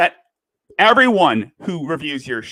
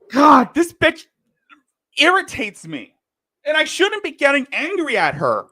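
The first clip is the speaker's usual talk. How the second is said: exasperated; 9 semitones higher than usual. Real, not acted. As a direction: let the irritation flare up. Exasperated outburst after apology.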